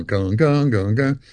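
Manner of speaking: low vocal imitation of plucked strings; evenly repeated low twangs; imitated tuning notes. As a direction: sound out guitar strings being tuned. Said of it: "am tuning to the piano".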